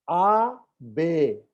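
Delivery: आ, बे stretched very slowly. Shown as a demonstration. Teacher slowly modelling French letters आ, बे. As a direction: draw out आ and बे slowly. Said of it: "बड़े धीमे से बोलता हूं".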